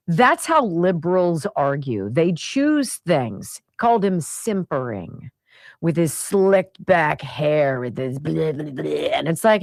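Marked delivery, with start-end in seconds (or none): none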